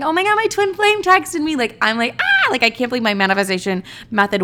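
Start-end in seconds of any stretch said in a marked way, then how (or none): 0.0-1.5 very high-pitched excited squeal; freaking out happily. Retold or imitated. Calling friends after the twin flame texts, squealing. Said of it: "I am so happy, and I'm freaking out". 2.2-2.5 very high, thrilled little shriek; short squeal of pure glee; excited squeal. Retold or imitated. Celebrating that the manifestation worked, excited squeal. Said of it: "and I'm freaking out".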